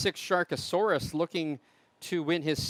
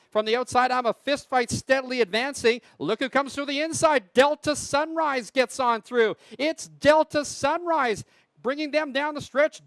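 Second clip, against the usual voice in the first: race caller; 3 semitones higher, 7 dB louder than usual. Real, not acted. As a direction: race call building to higher, louder finish. Live harness race call.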